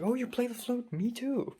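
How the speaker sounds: high, excited mock gush. Japanese classmate thrilled to learn he plays flute, high excited gush. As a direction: play the delighted classmate finding a match.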